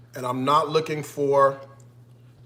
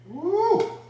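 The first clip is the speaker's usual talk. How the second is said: high, drawn-out "woo"; high whoop. Real, not acted. Home cook whooping at the stove, high whoop. Exclaims while cooking.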